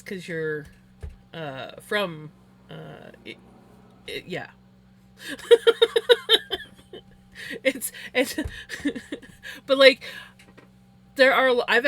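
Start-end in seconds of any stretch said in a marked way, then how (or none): 5.2-6.6 loud, high burst of giggles; real laugh. Real, not acted. Laughing at own stumbling.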